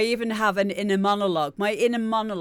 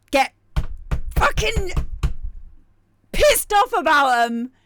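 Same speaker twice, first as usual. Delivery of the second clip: louder, higher comedic rant; mock anger; 9 semitones higher, 7 dB louder than usual. Streamer explaining his rants, in mock fury. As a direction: play up the fury for laughs. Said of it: "in a comedic way".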